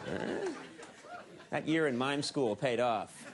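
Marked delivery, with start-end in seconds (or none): 0.1-0.5 mock surprise. Real, not acted. Mime reaction, high huh.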